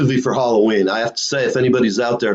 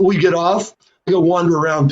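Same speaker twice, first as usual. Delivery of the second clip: ordinary talk, nothing marked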